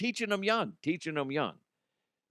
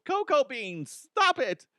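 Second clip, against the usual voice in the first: high fussy owner soothing her dog; high-pitched pet owner pleading; mock dog owner; 11 semitones higher, slower than usual. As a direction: coo at the dog like the lady in the park. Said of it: "She's like".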